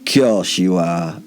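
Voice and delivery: slow, patronising drawl; slow, condescending agreement. Acted. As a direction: humour them as if they were children. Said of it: "I said in my most patronising voice".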